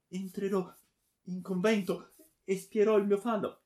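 high, imploring promise; desperate bargaining. A narrator's reading. Daughter offering to enter a convent, desperate. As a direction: beg in a high, anguished voice.

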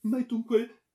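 very high, loud wail; horrified disbelief. A narrator's reading. Daughter asking if he will kill her, horrified. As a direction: cry the question out in terror.

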